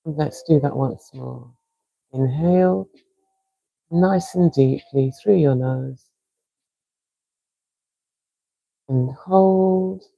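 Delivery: calm, unhurried breathing coach; soothing breathwork guide with long pauses; guided breathing. Acted. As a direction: count them through each breath gently. Leads a guided breathing exercise.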